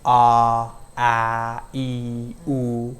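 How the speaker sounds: ah, ah, ee, oo as pure single vowels; vowel demo. Shown as a demonstration. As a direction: hold each vowel steady, one mouth movement. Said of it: "For example".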